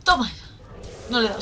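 ordinary talk

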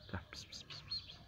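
soft unvoiced pis pis to lure a kitten; whispered kitty call. Real, not acted. Whispering pis pis to coax a shy kitten closer. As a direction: breathe the call so the kitten is not scared.

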